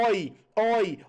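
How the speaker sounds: "oi" with open o, falling; showing the sound. Shown as a demonstration. Teacher demonstrating the sound in herói, open "oi". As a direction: drop each "oi" with a wide open o. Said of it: "ó o som".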